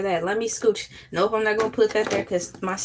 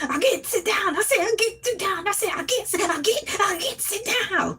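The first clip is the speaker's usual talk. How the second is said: high, rhythmic Southern catchphrase on repeat; remixed Southern teacher impression; 9 semitones higher than usual.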